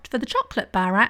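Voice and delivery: normal talking voice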